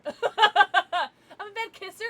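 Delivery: loud laughing high-pitched admission; laughing confession. Real, not acted. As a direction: squeal it out while laughing. Laughing self-mock confession.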